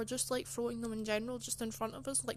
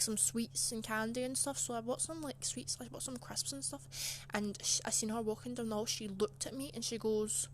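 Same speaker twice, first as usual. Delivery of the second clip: usual speaking voice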